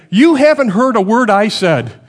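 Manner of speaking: hammed-up scolding, voice thrown high; exasperated wife. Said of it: "and she'll say".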